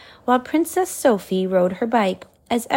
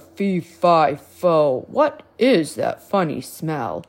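low, loud giant voice with a boom on the first words; giant bellow; 3 semitones lower, 4 dB louder than usual. A narrator's reading. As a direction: bellow it out like a big grumpy giant. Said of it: "The giant bellowed".

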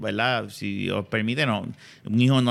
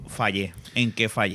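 mild despair; 5 semitones lower, 5 dB quieter than usual. Retold or imitated.

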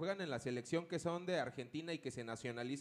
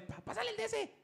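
much higher, mock mother's scolding voice; mocking the mom. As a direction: squeak it out like an exasperated mom. Mother scolding son at home. (imitando a la mamá regañando con voz muy aguda)

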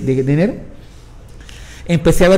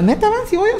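eager question. Retold or imitated. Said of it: "le digo a la Mara".

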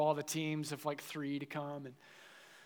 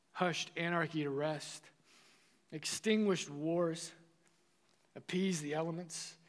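slow, measured recitation with weighty pauses; solemn quotation reading; 3 dB quieter, slower than usual. A narrator's reading. Preacher reading Chrysostom on prayer to the congregation, solemn recitation. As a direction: read it slowly, phrase by phrase.

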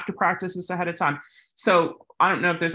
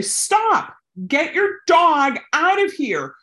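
yelling; 8 semitones higher than usual. Shown as a demonstration. Demonstrating to viewers how to yell at an owner, high shout. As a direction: shout to stop the charging dog. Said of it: "you can say".

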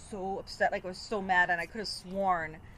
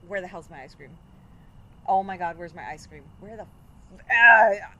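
retold anger; 3 semitones lower, 6 dB louder than usual. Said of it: "I get pissed".

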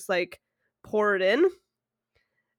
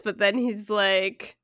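ordinary talk

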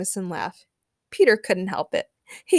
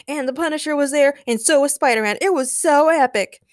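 lively, raised voice with loud peaks; excited shouting; 6 semitones higher, 5 dB louder than usual. A narrator's reading. Daniel recounts his night to Peter, excited. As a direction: gush over a thrilling story. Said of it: "shouted Daniel".

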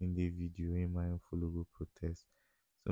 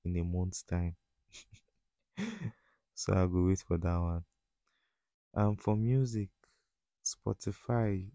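ordinary delivery

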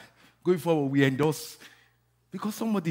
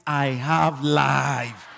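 loud, very slow, drawn-out declaration; with attitude; 7 dB louder, much slower than usual. Shown as a demonstration. Preacher coaching the congregation to say it boldly, with attitude. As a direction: stretch each word with attitude. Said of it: "Say it with an attitude".

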